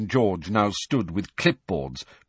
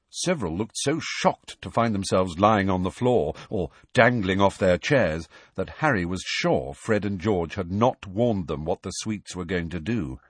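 usual speaking voice

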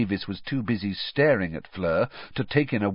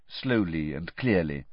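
ordinary delivery, nothing marked

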